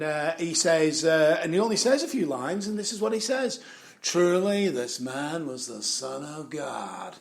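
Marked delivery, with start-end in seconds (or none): 4.0-7.2 slow American cowboy drawl, imitating; slow, low-key John Wayne drawl. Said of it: "in his John Wayne voice".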